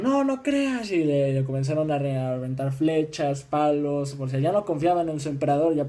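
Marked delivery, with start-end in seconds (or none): 0.0-0.9 high-pitched put-on refusal; mock disbelieving crowd. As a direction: imitate a crowd brushing him off, pitched way up. (réplica fingida y aguda de la multitud)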